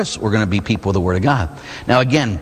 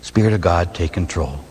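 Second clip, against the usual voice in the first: low, hushed prayer to the Spirit; 6 semitones lower than usual. Shown as a demonstration. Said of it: "I surrender to God's Holy Spirit".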